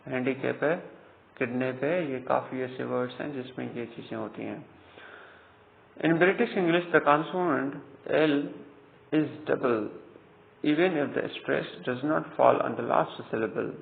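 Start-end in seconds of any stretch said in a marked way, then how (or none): 6.0-13.7 dictating a textbook rule at a very slow pace; slow rule dictation. Acted. Teacher reading the spelling rule to students, slow and deliberate. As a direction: read the rule out slowly and deliberately, word by word.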